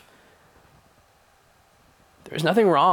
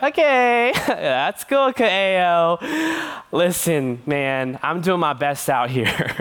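put-on high, laid-back teen shrugging off the sermon; laughing high mock brush-off; mock casual listener; 6 semitones higher, 4 dB louder, much slower than usual. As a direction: toss it off like a kid who's only half listening. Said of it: "you're like".

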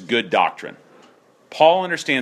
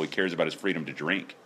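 ordinary delivery

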